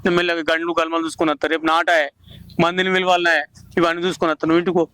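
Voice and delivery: low, soft weary resolve; quietly heartbroken old father; subdued sorrow. Acted. Grieving father after son's threats.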